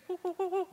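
very high monkey hoots; dad imitating monkeys for his toddler, high hoots; monkey whoops.

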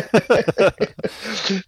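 high burst of real laughter. Real, not acted. Laughing about gluing themselves together, amused laugh. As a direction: crack up laughing.

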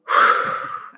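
long release of breath; demonstrated exhale. Shown as a demonstration. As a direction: let a deep breath out audibly. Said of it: "I let it out".

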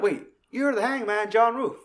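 high, surprised recognition; Mannix recognising. Retold or imitated. Chris Mannix recognising John Ruth, high surprised. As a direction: realise it with delight. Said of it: "He's like".

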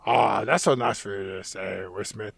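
low, soft put-on orc voice; mock-touched mumble, dropping away. Orc thanking his cop partner for praise, hammed-up low and touched. Voicing the orc's touched reply.

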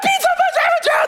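high, excited squealing shout; mock kids' shout of joy; kids cheering. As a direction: cheer like kids who just got a yes. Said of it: "they're like".

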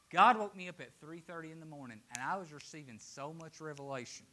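ordinary talk, nothing marked

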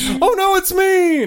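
high, loud squeaky bunny; panicked bunny voice. Pretend. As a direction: shriek it like a scared bunny. Acting out bunny and hawk.

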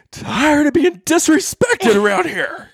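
high-pitched mock indignation; hammed-up impression of a fed-up wife; imitating annoyed wife. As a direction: squeal it in exaggerated outrage. Imitates wife's outburst, very high.

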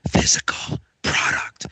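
slow hushed whisper. Shown as a demonstration. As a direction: breathe it out like a secret. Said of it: "I'm gonna whisper into my microphone here".

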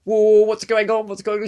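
high, loud mock alarm; startled brain voice. Acting out a viewer's brain reacting to the Russian, startled. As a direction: exclaim like a brain suddenly thrown, high and loud. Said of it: "it's like your brain goes".

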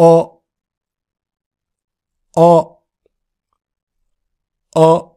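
isolated, muffled back あ; back-of-throat vowel. Shown as a demonstration. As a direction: demonstrate a throaty あ, exaggerated for practice. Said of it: "喉の奥で".

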